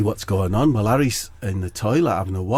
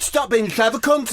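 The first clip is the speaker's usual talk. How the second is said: high, sing-song snap; a higher, cutting retort; Katie snapping; 11 semitones higher than usual. Acted.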